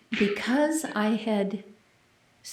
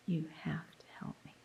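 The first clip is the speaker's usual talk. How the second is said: very soft, breathless whispered plea; whispered prayer; 3 semitones lower, 14 dB quieter, faster than usual. Retold or imitated. Quoting her silent plea to God during the test, whispered. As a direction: pray it under your breath, almost silent.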